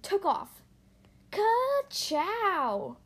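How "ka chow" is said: high, stretched-out whoop; exuberant catchphrase. A narrator's reading. Lightning taking off down the track, exuberant whoop. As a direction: whoop it high, dragging out both syllables.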